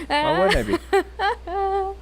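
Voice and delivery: high, very slow fake innocence; mock innocent. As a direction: stretch it out, playing innocent. Mock-innocent defense of team.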